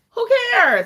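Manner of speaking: high, loud, exaggerated cry; loud high-pitched dismissal; dismissive shout. Real, not acted. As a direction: holler it out, brushing it off.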